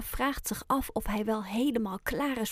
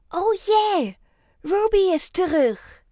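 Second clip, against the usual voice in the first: much higher, loud delighted cry; cheering; 8 semitones higher, 7 dB louder than usual. A narrator's reading. Ayoub cheers loudly that Ruby is back. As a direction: cheer for the returning friend. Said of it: "juicht hij".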